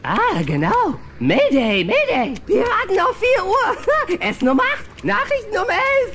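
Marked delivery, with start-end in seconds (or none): 1.2-6.1 high, hammed-up mayday call like a cartoon pilot; pretend pilot radio call. [name] playing pilot for Molly, high mock mayday call. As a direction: play at calling the tower in a mock emergency.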